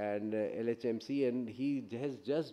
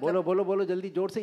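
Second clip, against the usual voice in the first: raised, higher call to the audience; loud urging; 6 semitones higher, 5 dB louder, much faster than usual. Real, not acted. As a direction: push them to speak up. Urging audience to answer loudly.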